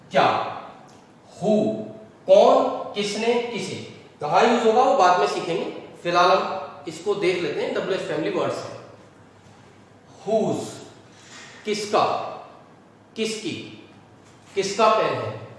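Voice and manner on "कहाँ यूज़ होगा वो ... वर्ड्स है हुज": normal talking voice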